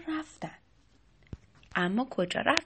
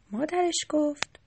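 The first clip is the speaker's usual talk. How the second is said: usual speaking voice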